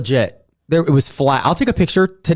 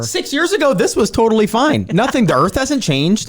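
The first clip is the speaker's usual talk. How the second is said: high-pitched exasperated argument; incredulous, high-voiced complaint; indignant protest; 9 semitones higher than usual. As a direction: argue it high, as if the rule is absurd. Acts indignant objection to building code.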